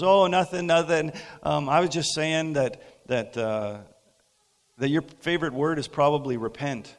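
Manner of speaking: teasing cousin. Retold or imitated.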